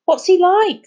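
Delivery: put-on excited kid, voice leaping up; breathless excitement. A narrator's reading. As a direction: burst out with the question. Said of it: "said Charlie breathlessly".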